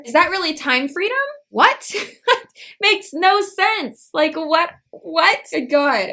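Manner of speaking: loud, high-pitched baffled disbelief; incredulous outburst. Real, not acted. Vlogger reacting to time freedom claim, loudly incredulous. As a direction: burst out, voice jumping up in disbelief. Ranting at the clip's contradiction.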